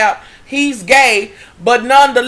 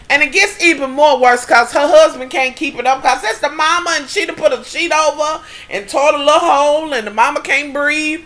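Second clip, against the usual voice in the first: ordinary talk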